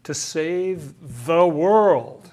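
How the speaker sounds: "To save the world" drawn out slowly, leaning on "world"; slow emphasis. Shown as a demonstration. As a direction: savour each word, slow and firm. Echoing the verse, savouring it.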